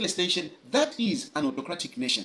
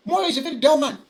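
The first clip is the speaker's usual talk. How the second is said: raised, high-pitched scornful declaration; loud scornful repeat; 8 semitones higher, 7 dB louder than usual. Real, not acted. Commentator scorning the speaker to his viewers, loud and high. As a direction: belt the insult out, much louder the second time. Repeats insult, much louder and higher.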